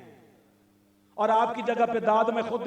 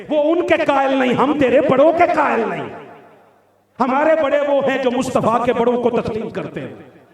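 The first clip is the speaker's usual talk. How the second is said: loud, raised-pitch polemic; fiery preaching rebuke; 6 semitones higher, 12 dB louder than usual. Real, not acted. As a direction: hurl the opponents' claim back at them forcefully. (बुलंद आवाज़ में जोशीली फटकार)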